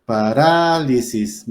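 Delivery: parálisis drawn out slowly on a long RÁ; stress on RÁ. Shown as a demonstration. Teacher giving an esdrújula example, parálisis with a long stressed RÁ. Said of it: "son esdrújulas".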